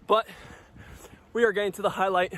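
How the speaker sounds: normal talking voice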